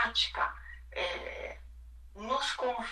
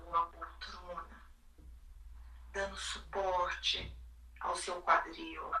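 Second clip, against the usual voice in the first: calm, hushed, sinking into quiet; soothing spa whisper; 7 dB quieter than usual. Acted. Leads a guided body meditation.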